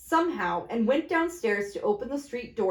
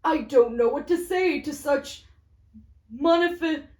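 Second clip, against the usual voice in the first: raised, tumbling gratitude; can't find the words; overwhelmed thanks; 5 semitones higher, 4 dB louder than usual. Acted. Gentleman overwhelmed.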